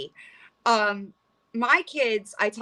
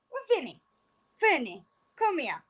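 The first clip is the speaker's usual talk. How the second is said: high sing-song pet talk, much higher; cooing to the cat; 6 semitones higher, 5 dB quieter than usual. Real, not acted. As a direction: coax the kitty over sweetly. Calling her cat over.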